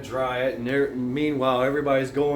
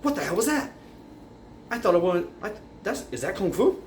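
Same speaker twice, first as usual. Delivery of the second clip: incredulous; 6 semitones higher than usual. Retold or imitated.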